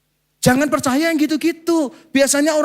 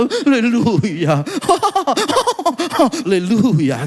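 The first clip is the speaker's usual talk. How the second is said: slow, trembling, drawn-out haleluya with a laugh; mock a pastor's quavering hallelujah; hammed-up vibrato hallelujah, laughing; much slower than usual. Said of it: "ngomongnya sambil bergetar-getar".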